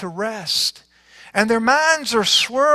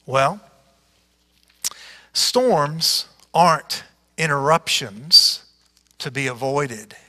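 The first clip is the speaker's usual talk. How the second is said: ordinary delivery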